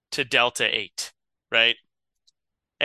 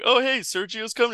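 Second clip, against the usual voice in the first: higher-pitched casual guard voice; guard's greeting; 9 semitones higher than usual. Acted. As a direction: call out lightly, pitched up.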